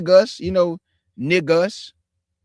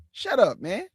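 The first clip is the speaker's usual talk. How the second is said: usual speaking voice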